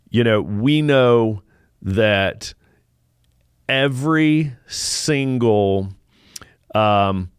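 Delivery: slow, weighty emphasis; grave commanding briefing. Acted. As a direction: press each word home like a preacher. Emphatic slow preaching build-up.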